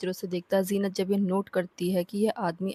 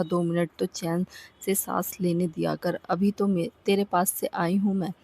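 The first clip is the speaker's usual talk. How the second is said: ordinary delivery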